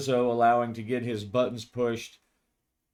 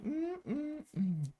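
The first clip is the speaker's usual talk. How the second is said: amused hum; 8 dB quieter than usual. Acted. Kathy hums, shaking her head.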